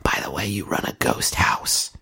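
exasperated stage whisper; mock urgent whisper. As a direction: whisper it as a hushed plea to the host. Mock urgent aside to Boo.

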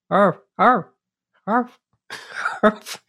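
very high yappy barks; yappy chihuahua voice. Pretend. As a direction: yap like a tiny dog. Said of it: "what your Bigfoot sounds like".

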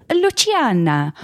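Luciana said loo-tee-AH-na with exaggerated flair; overly fancy wrong pronunciation. Shown as a demonstration. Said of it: "names that I'm gonna try to overpronounce".

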